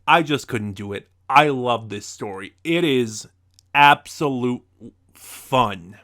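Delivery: ordinary delivery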